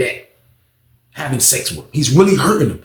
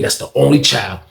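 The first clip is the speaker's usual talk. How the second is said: usual speaking voice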